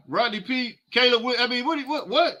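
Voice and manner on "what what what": ordinary talk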